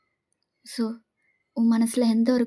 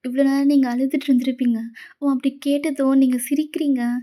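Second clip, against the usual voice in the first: plain reading voice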